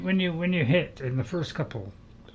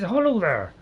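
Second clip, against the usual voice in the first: put-on Irish accent; 5 semitones higher, 5 dB louder than usual. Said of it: "Almost slipped into an Irish accent there".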